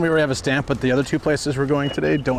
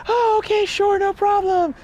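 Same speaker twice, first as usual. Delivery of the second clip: much higher, slow sweet agreeing; fake-nice phone voice. Imitating the manager agreeing on the phone, much higher and slower. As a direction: put on the boss's sugary phone politeness.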